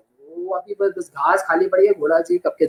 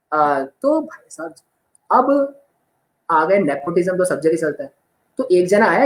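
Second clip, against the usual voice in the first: ordinary talk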